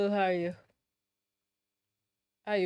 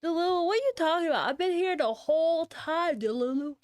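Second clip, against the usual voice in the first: high, fast, incredulous protest; exasperated protest; 5 semitones higher, 3 dB louder, faster than usual. Real, not acted. Streamer telling Delulu they were here, exasperated. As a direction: protest in a raised, higher pitch.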